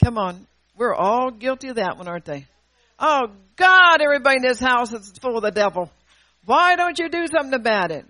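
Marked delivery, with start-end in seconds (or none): 3.0-8.0 louder mock wail of complaint, fast in the middle. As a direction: ham up a self-pitying prayer. Acting a whiny complaint to God.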